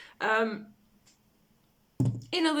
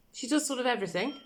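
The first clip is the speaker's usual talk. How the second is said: ordinary talk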